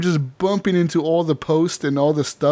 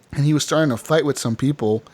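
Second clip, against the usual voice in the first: ordinary talk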